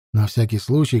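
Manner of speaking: ordinary delivery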